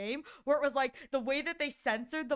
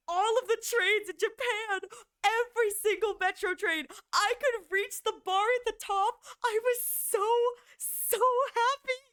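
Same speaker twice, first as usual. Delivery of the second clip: excited; 7 semitones higher than usual. Real, not acted. Joy recalling Japan trains.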